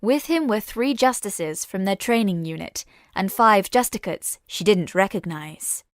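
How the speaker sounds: ordinary delivery, nothing marked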